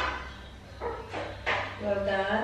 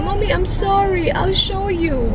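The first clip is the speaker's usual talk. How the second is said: put-on higher, slower little-girl apology; imitating her daughter; 6 semitones higher, slower than usual. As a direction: lightly voice a small girl saying sorry. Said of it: "Tapos sabi niya".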